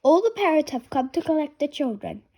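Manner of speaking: ordinary delivery, nothing marked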